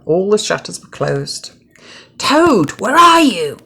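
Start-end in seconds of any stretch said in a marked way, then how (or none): 2.2-3.6 high, loud searching call; cried out. A narrator's reading. Frog crying out for Toad in the dark house. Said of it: "cried Frog".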